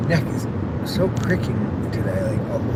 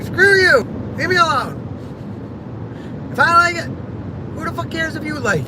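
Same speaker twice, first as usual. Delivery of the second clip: shouting back at her cousins over her hair; mock shout. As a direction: yell it at your cousins for real. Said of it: "That's more how I actually talked".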